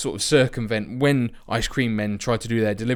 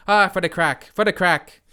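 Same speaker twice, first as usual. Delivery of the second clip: hammed-up Irish accent, a bit louder; mock Irish accent; 5 semitones higher, 4 dB louder than usual. To viewers, mocking Irish media in a mock Irish accent. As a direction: play a jokey Irish lad in an Irish accent. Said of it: "that would be typically Irish".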